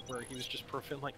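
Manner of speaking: usual speaking voice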